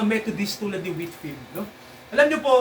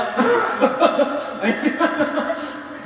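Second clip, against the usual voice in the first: shared laugh; 7 semitones higher than usual. Real, not acted. Pastor laughs with congregation.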